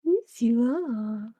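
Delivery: slow tender cooing; slow cooing to a lap cat. Real, not acted. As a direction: draw it out lovingly to the cat.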